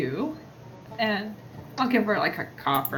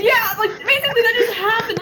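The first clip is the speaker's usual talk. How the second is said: excited shout; 9 semitones higher, 4 dB louder, faster than usual. Real, not acted.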